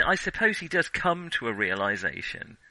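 ordinary talk

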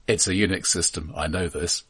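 ordinary talk

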